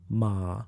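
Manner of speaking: MA dips low and soft. Shown as a demonstration. Teacher demonstrates Chinese sounds, low dipping tone. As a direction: sink MA to the bottom of the voice. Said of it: "Now the Chinese sounds".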